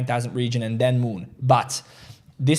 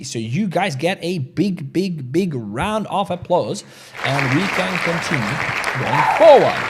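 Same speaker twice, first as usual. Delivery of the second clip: showy announcement; 3 semitones higher, 3 dB louder, slower than usual. Real, not acted. Applause for the likes.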